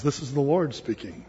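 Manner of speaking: low, soft voice of God; voice from heaven. Acted. God answers the stranded climber, low heavenly voice. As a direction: speak down low and calm, as God. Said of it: "he hears a voice from heaven".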